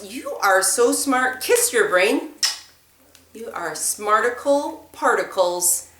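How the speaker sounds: ordinary talk, nothing marked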